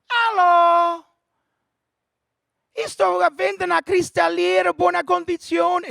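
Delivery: high, loud, shouted caller on a bad phone line; high loud shouting radio caller; old Italian caller. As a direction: yell into the phone like a nonna.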